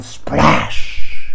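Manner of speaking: loud splashing cry. A narrator's reading. Narrator calling out the bucket splash. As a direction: call out the splash.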